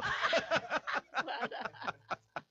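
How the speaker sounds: long rising-then-falling laugh; high delighted laugh. Real, not acted. Laughing at his friend's guaro saying. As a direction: let the laugh ring out.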